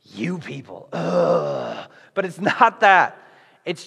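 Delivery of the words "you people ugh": a hint of exasperated sighing; lightly voiced, fed-up dismissal; condescending frustration. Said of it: "condescending frustration".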